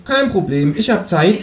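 high kangaroo voice; short high line as the kangaroo; kangaroo character. Acted. Kangaroo tells the neighbour what is missing, high-voiced. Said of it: "sagt das Känguru".

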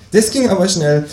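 a bright squeaky kangaroo hello. Acted. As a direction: kangaroo at the door greeting neighbour. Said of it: "kangaroo speaking, laughing".

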